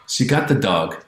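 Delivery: ordinary talk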